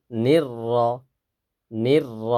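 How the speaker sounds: ordinary talk, nothing marked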